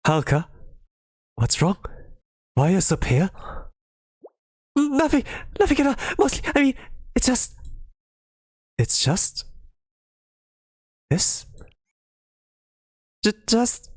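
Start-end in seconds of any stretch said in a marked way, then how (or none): 4.8-13.9 high, nervous stammer, pitch way up; panicked stammer. Acted. Haruka hiding what she did, high panicked stammer. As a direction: stumble over the words in panic.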